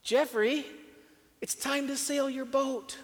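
high, loud, slow call. A narrator's reading. Father calling for his son to sail the boat, high and loud. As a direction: call out searching for him.

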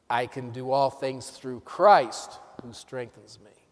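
"Christ" loud and drawn out, slow line; stress on CHRIST. Shown as a demonstration. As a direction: lean hard on "Christ", then drop away.

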